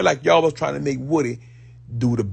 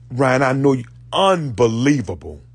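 ordinary talk, nothing marked

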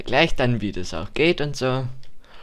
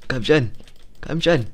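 eager, rising urging; aufgeregtes Anfeuern; 4 semitones higher than usual. Real, not acted. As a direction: cheer the fighter on. Anfeuern im Kampf. (aufgeregt anfeuernd)

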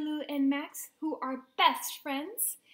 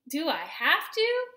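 louder, reluctant whine; whining; 3 semitones higher, 6 dB louder than usual. A narrator's reading. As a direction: moan about an unwanted chore. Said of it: "Raccoon whined".

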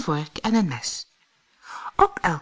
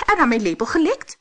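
higher, louder, rapid question; brisk raised-voice exclamation; dwarf calling out; 8 semitones higher, 5 dB louder, faster than usual. A narrator's reading. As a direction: chime in quickly as an affronted dwarf. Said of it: "riep de vierde".

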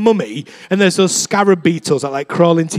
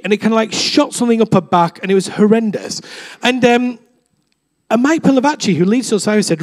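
usual speaking voice

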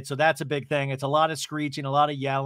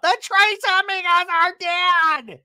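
very high, loud, drawn-out scream; mock screeching; 8 dB louder, slower than usual. Tamara declaring the Trace Amigas dead, imitated screaming. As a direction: screech it in exaggerated re-enactment. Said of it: "Tamara screams out".

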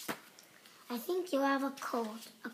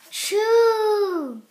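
big acted ah-choo, higher and louder; loud sneeze; 5 semitones higher, 13 dB louder than usual. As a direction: let the sneeze explode out. The sneeze lands.

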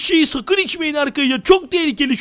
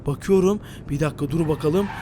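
ordinary delivery

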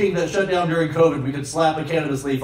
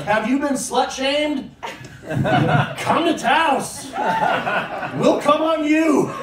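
higher, slow cheesy billboard pitch; mock ad slogan; 6 semitones higher, slower than usual. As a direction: sell Taos like a hammy tourism ad.

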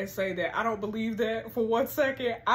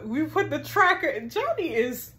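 ordinary talk, nothing marked

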